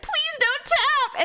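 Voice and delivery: high, slow begging; drawn-out high plea to the TV; pleading. Retold or imitated. Begging contestant on TV.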